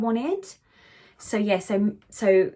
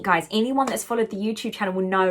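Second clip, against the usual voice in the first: ordinary talk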